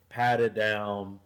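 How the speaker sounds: usual speaking voice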